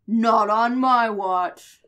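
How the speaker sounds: loud, drawn-out mock-hero declaration; heroic defiance. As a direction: stretch it out like an action hero. Acts out the hero's defiant line.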